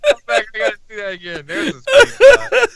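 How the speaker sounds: high-pitched words through laughter; laughing hard. Real, not acted. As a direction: talk while still cracking up. Laughing while rewinding the clip.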